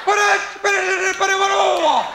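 high, garbled mock-mother yell; garbled angry yelling. Angry mother yelling at her child, hammed-up unintelligible yelling. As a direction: yell so furiously the words turn to gibberish. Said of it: "she yells at you".

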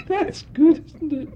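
high, silly showgirl voice; Amy MacDonald. Retold or imitated. Showgirl asking the audience about astrology, in a silly high voice. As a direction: squeak it out to the crowd. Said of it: "she'd say".

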